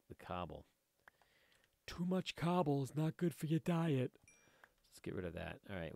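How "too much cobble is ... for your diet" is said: higher, silly character voice; put-on advice voice. Player jokes about collecting too much cobble, higher put-on voice.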